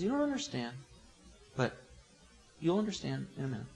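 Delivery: soft, hushed reassurance with pauses; very gentle. Acted. Jesus answers Peter's objection, very gentle. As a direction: let him down softly. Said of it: "Very gentle".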